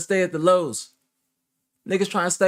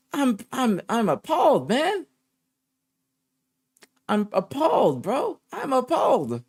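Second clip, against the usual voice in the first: appalled; 9 semitones higher than usual. Real, not acted. Appalled exclamation.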